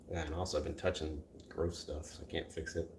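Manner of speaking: fading to a whisper; quiet aside. Real, not acted. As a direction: trail off into a breathy whisper. Trailing aside, drops to unvoiced.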